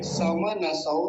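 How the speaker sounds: ordinary delivery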